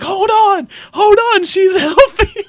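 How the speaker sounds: hammed-up calming the wife; reassuring Tengen. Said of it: "And he's like".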